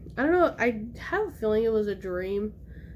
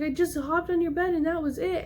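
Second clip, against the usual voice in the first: high-pitched, mildly tearful defense; retold crying; 7 semitones higher than usual. Child explaining to mom, mock crying. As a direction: act out a kid pleading innocence. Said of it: "and I'm still crying".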